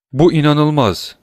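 ordinary delivery, nothing marked

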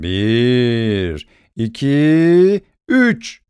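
showman building to the reveal; dramatic countdown. A narrator's reading. Dramatic countdown before unmasking.

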